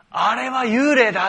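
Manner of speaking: 惊恐地高声喊出; 像看见鬼一样惊叫; frightened cry. Acted. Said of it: "言って怯え".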